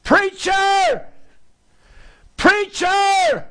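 high loud desperate cry of Preacher; a woman screaming for the preacher; screaming call. Retold or imitated. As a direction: call out across the church, pleading. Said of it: "just almost screaming at me".

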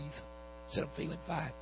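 near-whisper, breathless and weak; barely voiced. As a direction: push the words out with no air. Hurting, can't breathe, says fine.

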